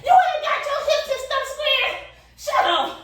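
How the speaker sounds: high, squeaky mocking critic voice; put-on high-pitched scolding, ending in a curt shut-up; nitpicking yoga people. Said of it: "you gonna have yoga people. So it's like".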